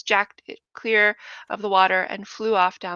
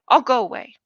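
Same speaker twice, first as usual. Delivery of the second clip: repeated line; 4 semitones higher, 8 dB louder than usual. A narrator's reading. Lenny repeats his line.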